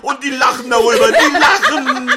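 high, loud indignant wail; outraged cry. Acted. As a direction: cry out at their laughing. Empört, dass die Frauen lachen. (schrill empört)

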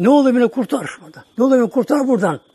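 high, loud begging, rushing the second line; desperate pleading cry. Retold or imitated. Man in hell begs for rescue, desperate high pleading. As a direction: plead to be pulled out of the fire.

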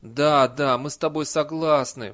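loud, drawn-out pleading assent; half groan the agreement; near-moaning agreement. A narrator's reading. Villager hastily agrees with Nanny, near moaning. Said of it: "почти простонал беднокур".

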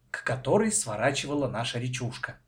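plain reading voice

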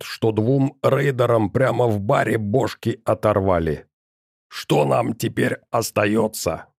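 a hulking giant gurgling menace; bubbling lava golem. A narrator's reading. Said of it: "знакомый булькающий голос".

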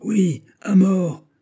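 drawn-out, high, loud mob yell; furious howl. A narrator's reading. A hundred furious men answer the mob, furious howl. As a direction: howl it slowly as one of a raging crowd. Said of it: "hurlèrent une centaine de furieux".

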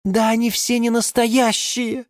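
high-pitched tearful complaint; sobbing geranium. A narrator's reading. Geranium complains about rival catalogue plants, sobbing. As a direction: whimper it in a high character voice. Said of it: "всхлипнула вдруг герань Антонина".

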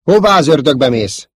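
loud, high, exasperated demand; urgent challenge. Acted. Commander demanding where his pilot is going, urgent. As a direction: call after a straying pilot.

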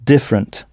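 different, DIF- hit hard, rest swallowed; stress on first syllable. Shown as a demonstration. Teacher showing the learner, different stressed on the first syllable. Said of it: "En insistant bien sur la première syllabe".

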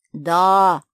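loud voice calling back; answering bear voice. A narrator's reading. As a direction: play Max the bear answering loudly. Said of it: "отозвался знакомый голос". (громкий отклик медведя)

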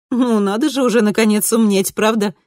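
bright, peaceable daughter voice; smooth things over brightly. A narrator's reading. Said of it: "миролюбиво ответила Кристина".

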